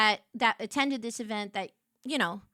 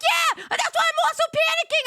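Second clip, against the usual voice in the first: very high, loud, giddy; mock panic; 8 dB louder than usual. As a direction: squeal it like a caught thief. Sister admits panicking.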